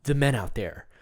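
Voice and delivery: usual speaking voice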